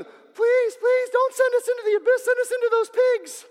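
high, whiny begging demon voice; pleading demons. Acted. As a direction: beg desperately in a squeaky voice.

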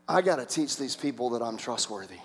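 low, subdued voice of a weary God; soft, deep, patient exasperation; God, exasperated. Acted. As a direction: drop low, as if resigned to a long job. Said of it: "and God's going".

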